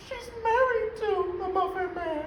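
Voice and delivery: high put-on voice. Acted take of the line.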